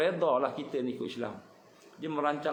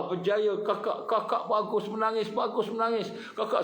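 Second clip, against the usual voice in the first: excited praise from a director, a bit higher; director praising; 9 semitones higher, 3 dB louder than usual. Retold or imitated. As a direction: cheer the actress on like a delighted director. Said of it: "kata pengarah". (pengarah memuji dengan teruja)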